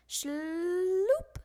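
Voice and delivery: long rising shloop noise; dragging shloop. A narrator's reading. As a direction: drag the sound out. Sack dragging sound.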